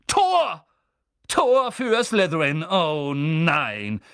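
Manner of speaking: high loud shout sinking into dismay; drawn-out stadium call falling in disappointment; commentator's goal call. Acted. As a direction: cry the goal, then let it sag. Commentator calls a goal against his team.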